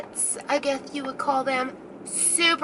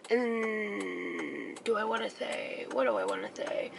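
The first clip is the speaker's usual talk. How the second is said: softer, trailing into a whisper; words barely voiced, much softer; hushed aside; 3 semitones lower, 10 dB quieter than usual. Real, not acted. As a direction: drop to a secret whisper. Hesitating over a touchy detail.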